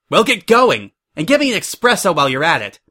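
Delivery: loud, high, impatient bossing; snapped order. A narrator's reading. Suri sends the intern for espresso, snapping impatiently. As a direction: bark it at an underling, fast finish.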